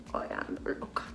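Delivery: whispered repeat. Shown as a demonstration. Repeating her line to viewers: whispered echo. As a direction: repeat it under her breath.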